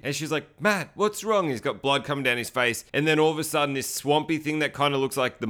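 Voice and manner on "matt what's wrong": higher-pitched imitated worry; mock concern. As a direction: ask in a high, lightly acted worried voice. Acts out worried woman finding partner.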